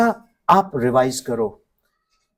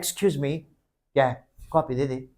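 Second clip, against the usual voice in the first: hushed student request; 3 semitones lower, 10 dB quieter than usual. Retold or imitated. Student asking classmate for copy.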